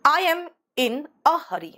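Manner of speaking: "I am in a hurry" spoken very slowly, word by word, for students to copy; slow model reading. Shown as a demonstration. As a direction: spell out each word slowly like a teacher. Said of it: "मैं हड़बड़ी में हूँ".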